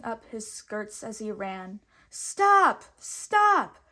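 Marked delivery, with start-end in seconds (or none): 2.1-3.8 much higher and louder urgent cry; shouting stop. Acted. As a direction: yell after the coach to halt it. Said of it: "cried Robin".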